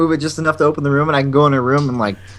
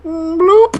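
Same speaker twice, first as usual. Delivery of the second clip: high, loud comic bloop; imitated gulp noise; 14 semitones higher, 5 dB louder than usual. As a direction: pop out a high swallowing sound. Imitates popping food into mouth.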